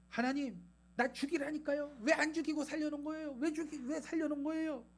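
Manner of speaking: high-pitched strained complaint; mock tantrum. Jonah demands to know why he was spared, in temper. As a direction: whine angrily at God, pitch held high. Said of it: "막 하면서 이제 성질을 냅니다".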